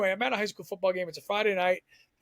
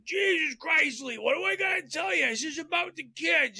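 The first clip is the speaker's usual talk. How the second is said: much higher, exasperated shouted coach voice; Gabby voice; 10 semitones higher than usual. Retold or imitated. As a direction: play a fed-up coach scolding the cameraman, pitched way up. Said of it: "I'll do my best Gabby voice".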